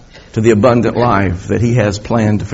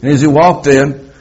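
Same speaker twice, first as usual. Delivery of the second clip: ordinary talk, nothing marked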